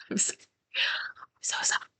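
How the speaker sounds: breathless, voiceless apology through laughter; helpless laughter. Real, not acted. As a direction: crack up and barely squeeze the words out. Cracks up after accent attempt.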